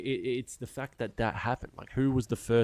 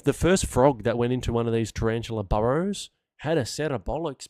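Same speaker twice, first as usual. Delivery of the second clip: ordinary delivery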